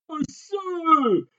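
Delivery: high, loud furious shout. A narrator's reading. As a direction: shout it in rage. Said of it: "criait le capitaine furieux".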